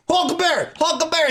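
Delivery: high, insistent calling of the name; worried buddy calling his name; mock urgent calling. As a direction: call him back to his senses. Shaking a lost friend.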